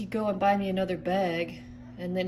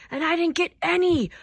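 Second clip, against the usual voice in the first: high, angry complaint; mad; 7 semitones higher, 4 dB louder than usual. Acted. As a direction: protest it crossly, pitch climbing. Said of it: "I'm so mad at you".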